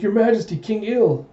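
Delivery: high, mock-startled greeting; startled exclamation. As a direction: exclaim as if caught off guard by royalty. Startled greeting to the arriving king.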